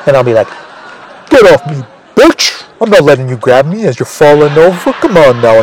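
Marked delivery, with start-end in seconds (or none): 1.3-5.6 angry brush-off. Retold or imitated.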